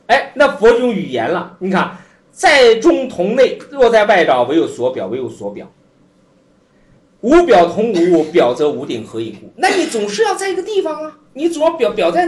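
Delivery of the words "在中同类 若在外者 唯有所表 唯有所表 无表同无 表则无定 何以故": recited scripture. Acted. Teacher reads sutra passage.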